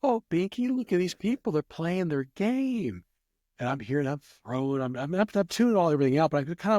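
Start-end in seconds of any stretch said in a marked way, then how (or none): 0.0-3.0 high-pitched lady talking to her pet; imitated lady. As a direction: put on a high female voice, slowing toward the end. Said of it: "She goes".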